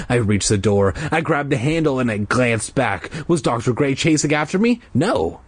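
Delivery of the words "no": usual speaking voice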